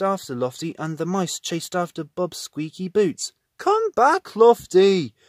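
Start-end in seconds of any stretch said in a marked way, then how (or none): 3.6-5.1 much higher, louder call. A narrator's reading. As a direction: shout after him. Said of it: "cried Bob".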